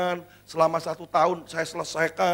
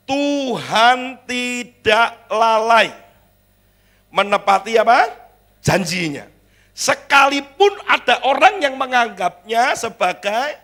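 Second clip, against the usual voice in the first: very slow, louder emphatic reading; louder, drawn-out pulpit proclamation; preaching scripture; 6 semitones higher, 10 dB louder, slower than usual. Acted. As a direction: drive each word of the verse home.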